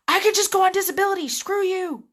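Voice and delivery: loud, high, rapid mock yell; exasperated outburst. Fed-up call center worker to hostile callers, mock furious yell. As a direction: blow up at the caller in hammed-up outrage.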